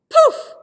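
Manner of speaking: very high, loud burst poof; magic poof. Acted. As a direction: pop it like a magic spell. Magic sound effect.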